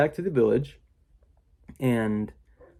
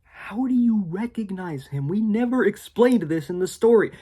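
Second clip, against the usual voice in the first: mock confused kid; 9 semitones higher, 4 dB louder than usual. Kids questioning the story's lore, higher and puzzled. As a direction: whine the plot-hole question. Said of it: "And it's like".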